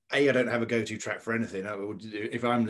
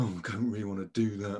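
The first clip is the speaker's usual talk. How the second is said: put-on reluctant inner child; 4 semitones lower, 4 dB quieter than usual. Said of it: "the inner child that's going".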